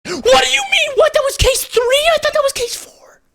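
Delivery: very high-pitched shriek of surprise; real shock, voice shooting way up; shocked disbelief. Real, not acted. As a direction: squeal it in stunned disbelief. Shocked at game chapter title.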